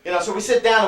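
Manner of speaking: normal talking voice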